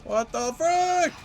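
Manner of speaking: high screaming panic; being chased. Real, not acted. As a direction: shriek while running for your life.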